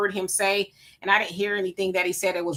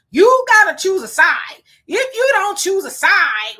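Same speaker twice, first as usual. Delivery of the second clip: mock insistence; 9 semitones higher, 8 dB louder, slower than usual. Mocks people demanding sides.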